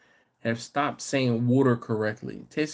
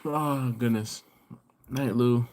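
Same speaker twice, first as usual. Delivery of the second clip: usual speaking voice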